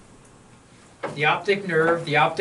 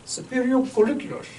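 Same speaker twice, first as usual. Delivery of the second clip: much higher mock German accent; 8 semitones higher than usual. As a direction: imitate an old German professor's accent. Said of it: "said in an accent of, like".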